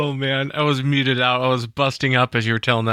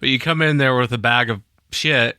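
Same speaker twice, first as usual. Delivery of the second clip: ordinary talk, nothing marked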